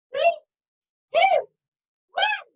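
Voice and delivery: high countdown with each number held apart; excited countdown. Real, not acted. Counting down the last seconds.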